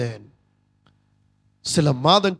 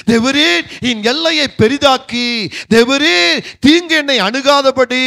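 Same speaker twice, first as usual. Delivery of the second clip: raised, fervent prayer, building to a high loud cry on ஆண்டவரே; fervent preached prayer; 3 semitones higher, 3 dB louder than usual. Acted. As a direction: pray it out with growing force. Voices Jabez's prayer, preaching. (உரத்த ஊக்கமான ஜெபம்)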